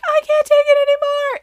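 hammed-up mom losing it; fed-up yell. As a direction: mom yelling at the balloons, high and loud. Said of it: "And I'm like".

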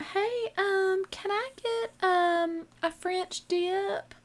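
high, meek ordering voice; sweet high-pitched tentative request; hesitant customer. Retold or imitated. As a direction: order timidly in a high, drawn-out voice.

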